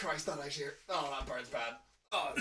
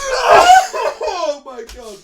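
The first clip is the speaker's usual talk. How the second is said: loud, high, drawn-out laughing cry; cracking up on the words; helpless laughter; 6 semitones higher, 13 dB louder, much slower than usual. Real, not acted. Co-host reacting to the silly reading, helpless laughter. Overcome laughing at the bad accent.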